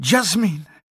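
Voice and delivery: high, loud startled call; startled cry. Acted. Teacher cries the girl's name in alarm, high call.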